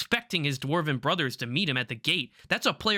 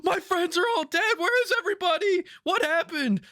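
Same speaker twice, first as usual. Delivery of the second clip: high, frantic mock panic; freaking out; 13 semitones higher than usual. Gimli's player finding his friends dead, freaking out. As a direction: play a player's wailing disbelief, pitched way up. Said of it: "He starts freaking out".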